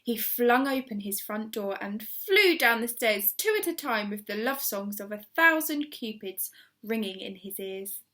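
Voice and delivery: ordinary delivery, nothing marked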